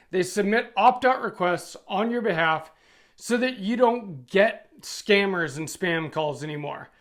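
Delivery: normal talking voice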